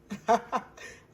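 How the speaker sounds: short bright laugh at her own joke. Real, not acted. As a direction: burst out laughing.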